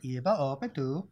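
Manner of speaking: slow, heavier Swedish accent on the name. Shown as a demonstration. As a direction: lean harder into the Swedish vowels. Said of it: "That was better".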